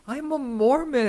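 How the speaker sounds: high, slow, prim declaration. Retold or imitated. Retelling a movie scene in a high prim voice. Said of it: "And he goes".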